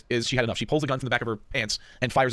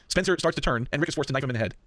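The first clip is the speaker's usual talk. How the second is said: ordinary talk, nothing marked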